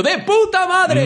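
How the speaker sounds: put-on very high, slow, gleeful kid shout of "¡De puta madre!". Children leaving the cinema, shouting the swear word. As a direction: yell it like a thrilled five-year-old. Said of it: "ídolos de infancia, diciendo".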